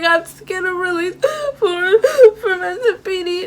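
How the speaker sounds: high, whiny mock sobs; retold crying. Daughter explaining the release form to dad, hammed-up crying. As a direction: imitate blubbering through the words. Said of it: "I'm crying so hard".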